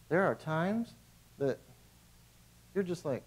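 ordinary talk

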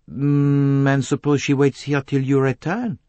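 Poirot, measured questioner. Acted. Poirot questioning Simon's plan, probing. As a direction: pose the objection as the detective.

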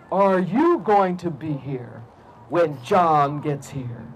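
very slow, low cat voice; low, drawn-out ominous question; huge talking cat. Acted. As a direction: menace him slowly as a pony-sized cat. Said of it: "looked at the man and said".

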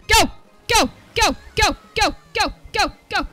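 rapid-fire repeated "go"; urging on. Real, not acted. Gamer urging the kart on, punchy go-go-go. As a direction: fire off each go separately.

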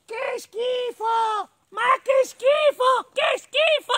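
very high squeaky mole, slow and loud; disgusted little mole. Acted. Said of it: "Esclamò la piccola talpa".

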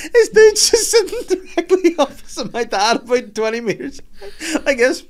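high, helpless laughter in the words; laughing while talking. Real, not acted. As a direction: barely get the story out for laughing. Cracking up while telling the story.

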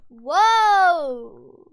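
sudden loud startled whoa. Real, not acted. Gamer startled by something on screen, loud whoa. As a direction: jolt out a big surprised whoa.

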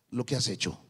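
ordinary delivery

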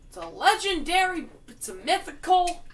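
loud, high thrilled gasp; mock awestruck discovery; retold excitement. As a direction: gush over the rare find. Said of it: "I was like".

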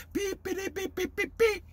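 high, soft staccato piper's beeps; imitated piping. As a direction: mimic a little pipe with quick peeps. Imitates the Pied Piper's pipe.

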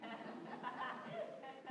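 airy unvoiced giggle that rises; breathless silent laugh. Real, not acted. Laughing at his own joke.

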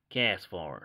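American short A in Castform; dodgy American accent. Pretend. Collector naming a card for viewers, put-on American accent. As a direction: say Castform with a cowboy-like American twang. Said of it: "gonna try my best American accent".

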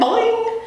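high springy repeated boings; frog's boing. Acted. Frog answers the hamster with high springy boings. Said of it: "he said".

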